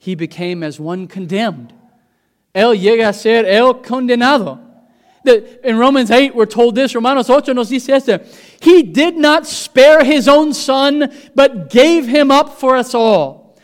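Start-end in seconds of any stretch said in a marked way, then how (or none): 2.5-13.5 high, loud preacher's cry; full-force preaching. Acted. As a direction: preach it out to the whole church with fire. Preaching in two languages.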